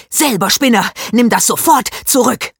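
put-on small boy lunging in rage; angry. A narrator's reading. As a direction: yell it at him. Henry attacks Max.

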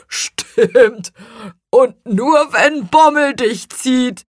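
high, loud teasing laugh in the voice. A narrator's reading. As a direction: tease as if laughing. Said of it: "lachte Rübenbach".

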